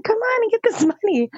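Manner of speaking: high, urgent plea to hurry; urgent calling. Retold or imitated. From the taxi, urging the mother over, high pleading call.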